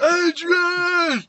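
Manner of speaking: very high, loud drawn-out scream; stretched, hammed-up cry; shouting for him. Shown as a demonstration. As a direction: bellow the name across the arena. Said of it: "How does she shout for her?".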